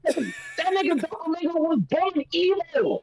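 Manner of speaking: high-pitched indignant yelling; worked-up outburst. Real, not acted. Angry fan objecting to panel's take, high mad yell. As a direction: yell it up high, overloading the mic. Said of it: "bring the volume down".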